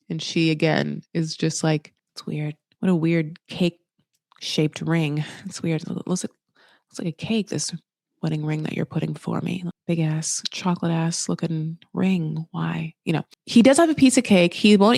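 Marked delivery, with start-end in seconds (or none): 2.1-12.9 soft, low mock grumble; muttered disappointment. Girlfriend eyeing a ring-shaped cake, soft mock grumbling. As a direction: mutter it under your breath as the let-down girlfriend.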